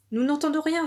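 high-pitched crowd complaint; displeased cry. A narrator's reading. Villagers complaining to the hare, crying out displeased. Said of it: "s'écriaient les villageois mécontents".

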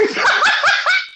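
high squealing laugh. Real, not acted. Teller at the cart punchline, high squealing laugh. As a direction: burst out laughing hard.

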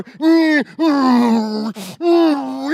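forceful digging grunts; loud effort grunts. Retold or imitated. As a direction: hum each push hard. Mimicking aggressive digging.

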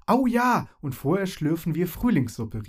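delighted cry, jumping at the idea; excited. A narrator's reading. Fairy Marone calls out, thrilled about the spring soup. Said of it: "rief Marone begeistert".

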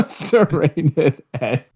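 slow, high amused echo. Pretend. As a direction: savor the words, amused and slow. Repeats the phrase.

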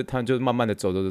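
normal talking voice

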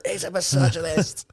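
high mocking quote of Tate; Andrew Tate impression. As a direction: squeak it out as a clip parody. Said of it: "That was well funny. That clip".

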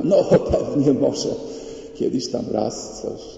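imitated evasive half-confession; grudging admission. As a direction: admit it reluctantly, slowly, playing it down. Acting evasive spouses admitting fault.

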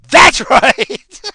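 loud, high laughing cackle. Real, not acted. Laughing at his own crude boast, loud and high. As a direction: crack up at the joke.

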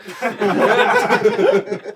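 high burst of real laughter; real laughing fit. Real, not acted.

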